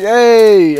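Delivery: re-enacted shout of "Hey!"; retold call-out. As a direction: mimic calling out to another player. Re-enacting his shout at the player.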